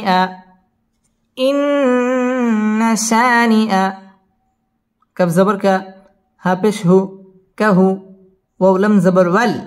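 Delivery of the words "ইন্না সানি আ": slow melodic chant, long held vowels; Quran recitation. Shown as a demonstration. Teacher models the verse for students, slow chanted tajweed. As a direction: intone each word, drawn out and high.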